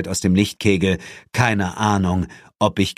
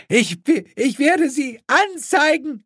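very high, stammered threat; hysterical screaming; slower than usual. A narrator's reading. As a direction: screech the threat, stumbling at first. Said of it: "schrie diese gerade". (hysterisch schreiend, sehr hohe Stimme)

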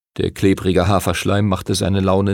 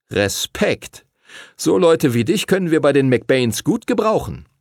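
lightly voiced approving schoolboy, pitched up; admiring older schoolboy; 5 semitones higher than usual. A narrator's reading. Older pupil welcomes Henry to the McBains, admiring.

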